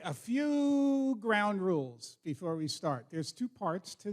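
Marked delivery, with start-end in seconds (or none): 0.0-2.2 drawn-out opener. Real, not acted. Opening rules to an audience.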